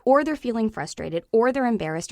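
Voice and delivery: normal talking voice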